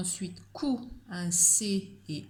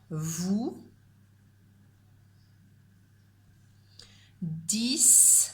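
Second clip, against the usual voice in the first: slow, spaced dictation, each word set apart; slower than usual. Shown as a demonstration. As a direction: read it out for pupils to write down. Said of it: "Je répète une dernière fois".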